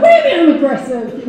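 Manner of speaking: loud, laughing indignation; mock indignant. To people calling her aggressive, mock indignant and loud. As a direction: act offended, half joking. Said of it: "And I'm like".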